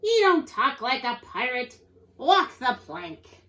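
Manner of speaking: loud captain rejecting a recruit; pirate bellow. A narrator's reading. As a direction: bellow it, then draw out the order. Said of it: "shouted Pete".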